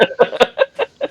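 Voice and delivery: high squealing laugh at the memory; burst of laughter. Retold or imitated. Telling friends, a high burst of laughter.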